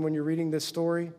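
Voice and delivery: ordinary talk, nothing marked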